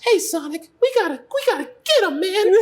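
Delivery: put-on squeaky, very high little-boy sidekick; high-pitched Puerto Rican kid cartoon voice; Tails. Shown as a demonstration. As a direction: pipe up as a tiny, eager buddy. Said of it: "like, a high-pitched".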